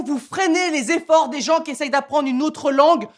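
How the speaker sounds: high, yelling rant; furious rant. Real, not acted. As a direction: blow up at the mockers. Ranting at mockers.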